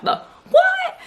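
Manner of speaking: high squeaky mock "The what?"; shocked disbelief. She and her mom react to the news, squeaky disbelief. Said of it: "Me and my mom are kinda like".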